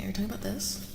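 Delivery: ordinary talk, nothing marked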